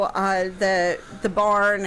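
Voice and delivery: ordinary talk, nothing marked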